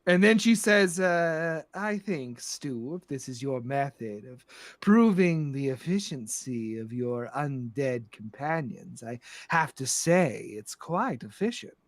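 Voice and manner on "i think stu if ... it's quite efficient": low, soft, measured grande dame; quietly imperious lady; composed, sinister madam. Acted. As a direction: appraise them with cool amusement. Plays Madam Storybrooke.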